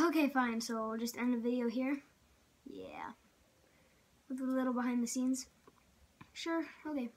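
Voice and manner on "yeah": whispering. Real, not acted. Quiet aside reply.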